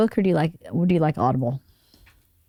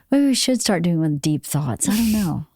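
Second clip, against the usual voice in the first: normal talking voice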